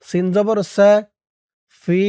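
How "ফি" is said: "ফি" with a long-held i; three-alif elongation. Shown as a demonstration. Teacher demonstrating madd munfasil, ফি drawn out long. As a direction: stretch ফি steadily before the next syllable. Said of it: "ফি তিন আলিফ টান".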